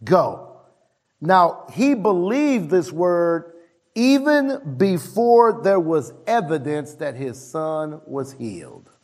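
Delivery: ordinary delivery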